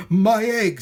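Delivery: high, loud cry of wonder; joyful shout. A narrator's reading. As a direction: shout it out in amazement. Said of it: "shouted Horton".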